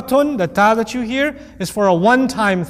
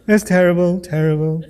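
Trump impression; 4 semitones lower than usual. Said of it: "I sounded like Trump".

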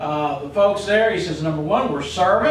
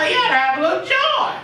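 high, lifted exclamation with lively lilt; joyful; 8 semitones higher than usual. Shown as a demonstration. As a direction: urge them brightly to have some joy. Contrasts joy with gloomy prayer.

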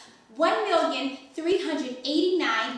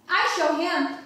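firm and defiant, a bit louder; 4 semitones higher, 4 dB louder than usual. Acted. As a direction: stand up to him with it. Defiant about her father.

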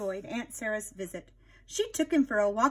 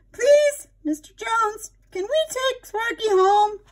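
put-on pleading girl; 13 semitones higher, 5 dB louder, slower than usual. Linda begs shopkeeper.

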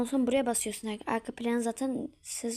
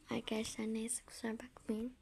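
whisper; 10 dB quieter than usual. Real, not acted. Apologetic whisper to viewers.

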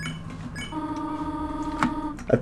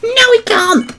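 squeaky retort. Real, not acted.

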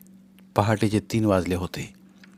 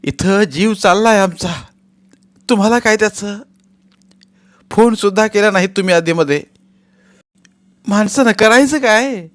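angry complaint; 10 semitones higher, 7 dB louder than usual. Acted. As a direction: let all the grievance out. Angry wife continues.

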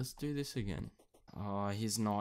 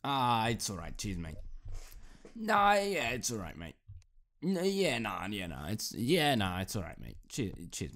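hammed-up Aussie accent, dropping lower at phrase ends; Australian accent; 4 semitones lower than usual. Shown as a demonstration. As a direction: do an easygoing Australian, laid on thick. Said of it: "That's how you do an Australian accent".

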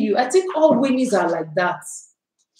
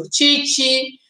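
normal talking voice

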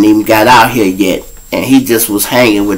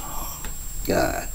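far softer, sinking grief; 17 dB quieter than usual. Retold or imitated. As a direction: breathe it out as he dies. Friend dies in his arms.

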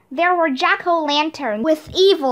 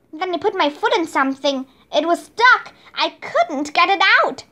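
plain reading voice

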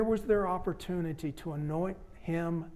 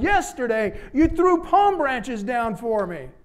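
loud reproach; 6 semitones higher, 8 dB louder, slower than usual. Acted. Donkey keeps shouting at market.

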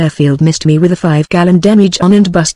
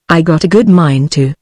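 ordinary delivery, nothing marked

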